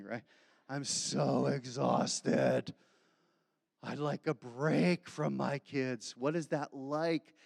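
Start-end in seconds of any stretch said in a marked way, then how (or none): 0.7-6.1 slow, drawn-out exhausted sigh of a voice; worn-out young parent. Retold or imitated. Young parent telling the pastor, slow and exhausted. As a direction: drag every word like you haven't slept. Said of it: "You young parents are like".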